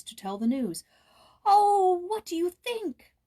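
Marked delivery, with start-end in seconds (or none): 1.4-3.1 put-on high, loud burst of boyish excitement; excited cry. A narrator's reading. Boy bursting to tell Joyce the news, excited cry. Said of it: "he cried".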